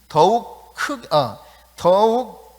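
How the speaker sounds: 더욱 stretched slow and loud; emphatic stress. Shown as a demonstration. Preacher hammering the added adverb, drawn-out emphatic stress. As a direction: draw 더욱 out with force. Stretches "more" to show loudness.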